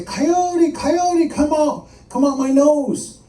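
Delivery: high, pleading monster wail; begging call. Acted. Monster begging Coyote out of his body, high pleading call. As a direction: call out high and beg them to leave. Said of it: "kept begging Coyote to come out".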